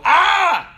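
put-on high, loud little-boy cry of frustration; frustrated yell. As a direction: yelp like a six-year-old whose hair is pulled. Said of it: "He'd go".

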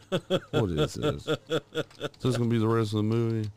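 exasperated moviegoer. Retold or imitated. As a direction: whine it in disbelief. Complaining in theater.

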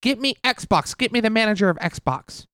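high, demanding Karen voice; entitled customer. Acted. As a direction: demand the manager like an angry Karen. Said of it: "My name is Karen".

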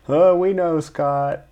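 high, loud humoring reply; mock placating. Crew reassuring their yelling boss, hammed-up placating. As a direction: humor him like an upset pal.

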